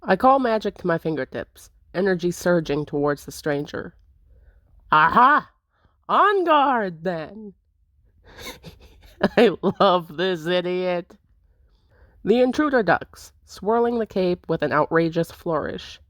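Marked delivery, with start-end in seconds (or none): 4.9-7.5 loud, high swashbuckling challenge; theatrical challenge. Acted. Intruder challenges to a duel. 8.5-11.2 chuckling through the words; laughing. Real, not acted. Laughing at the character.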